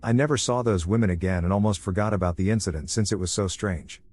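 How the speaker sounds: ordinary delivery, nothing marked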